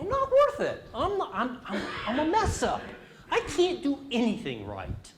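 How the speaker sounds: mock whine of a kid who feels worthless; self-critical inner voice. Teacher voicing the child's inner thought, high mock whine.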